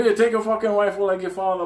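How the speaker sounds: high, fast, loud push; hammed-up insistent father; insistent old man. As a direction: shove the rifle on him. Hector handing rifle.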